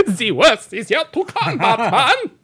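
put-on high, loud German accent breaking into laughter; hammed-up German menace, cracking up; mock German villain. Repeats villain line, laughing.

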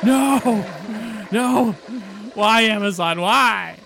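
loud, high mock wailing; mock despair. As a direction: wail it like a tragedy. Mock lament over stores.

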